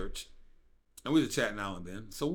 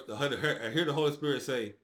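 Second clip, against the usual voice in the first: normal talking voice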